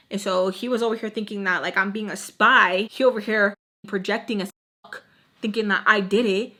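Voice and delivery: ordinary talk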